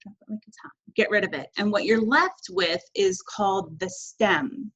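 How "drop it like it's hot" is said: whispered echo of the catchphrase. Shown as a demonstration. Teacher whispering the catchphrase back to students. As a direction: breathe the line as a secret echo. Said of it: "dropping the A R ending".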